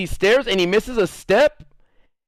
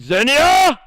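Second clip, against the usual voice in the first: high, loud screamed name; mock battle cry shout; battle cry yell; 8 semitones higher, 6 dB louder than usual. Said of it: "battle cry".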